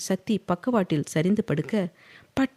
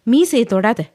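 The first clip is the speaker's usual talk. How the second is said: sharp warning; 5 semitones higher, 8 dB louder than usual. A narrator's reading. Slaps her hand away.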